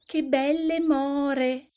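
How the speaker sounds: put-on high, slow child's exclamation; little girl exclaiming. A narrator's reading. Little Red spotting blackberries, exclaiming in girlish voice. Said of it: "esclamò".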